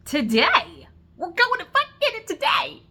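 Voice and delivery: loud, high yell: today!; shouting insistence. Retold or imitated. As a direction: shout it, no arguing. Insisting on getting chair now.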